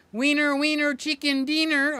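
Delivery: hammed-up Mexican accent, high and loud; loud cartoonish Mexican accent; mock Mexican accent. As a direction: ham up a Mexican accent on Wiener, wiener. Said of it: "I gotta say it like a good old Mexican".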